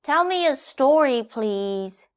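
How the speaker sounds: put-on child donkey wheedling; Little Donkey. A narrator's reading. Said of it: "said Little Donkey".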